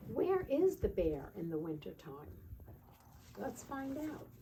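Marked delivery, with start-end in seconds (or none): none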